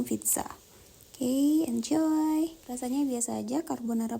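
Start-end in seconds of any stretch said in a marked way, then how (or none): 1.1-2.6 slow, high, drawn-out 'Oke, enjoy'; drawn-out cheerful. Real, not acted. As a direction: stretch it out invitingly. Presenting the food.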